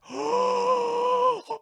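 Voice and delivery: big, loud theatrical gasp; huge high gasp; shocked gasp. Acted. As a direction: suck in air in exaggerated shock. Gasps at story reveal.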